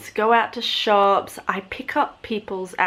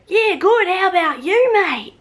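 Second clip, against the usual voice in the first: much higher, louder bogan Australian twang; copied bogan accent; 11 semitones higher, 6 dB louder than usual. Retold or imitated. Answering a bogan in the same broad Australian accent. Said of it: "And then I go, / real bogan Australians".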